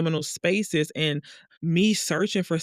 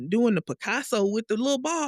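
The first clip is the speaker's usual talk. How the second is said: usual speaking voice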